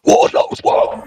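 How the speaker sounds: breathy whispered catchphrase; whispered echo. Pretend. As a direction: breathe the words out. Echoing catchphrase.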